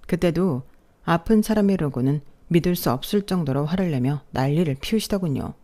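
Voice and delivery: plain reading voice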